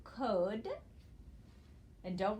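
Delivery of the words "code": "code" with a long o, rising; "code" said alone as a syllable; sounded-out syllable. Shown as a demonstration. Teacher reads the first part, "code" as an isolated syllable. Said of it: "and read it as".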